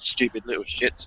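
low, soft sneer under the breath; muttered butler aside. Pretend. Butler cursing his masters out of earshot, low and soft. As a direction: grumble it once the lords have gone. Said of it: "when they're out of shot".

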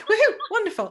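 high, loud mock woohoo; sarcastic cheer. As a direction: celebrate with laughing irony. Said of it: "I was like".

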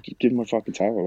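mild mock outburst over the missing towel; retold angry demand. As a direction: replay the fed-up shout at the police, half-earnest. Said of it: "that obviously then pissed me off further".

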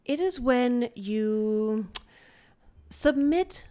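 slow, drawn-out mock-solemn pronouncement; cult leader. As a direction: preach it slowly like a cult leader. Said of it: "cult leader, and her cult was for sun boofing".

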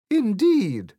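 high loud regal pronouncement; Queen. A narrator's reading. Queen accepts Alice's answer, grand and loud. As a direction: declare it grandly. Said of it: "said the Queen".

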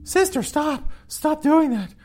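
squeaky high mock plea to stop; mock sister voice. Host voicing the sister breaking up a fight, high and squeaky.

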